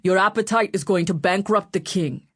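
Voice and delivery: dark northern grumble; northern accent. A narrator's reading. Berwick grumbles at the glutton, northern accent. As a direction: complain darkly, northern accent. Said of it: "in his northern accent".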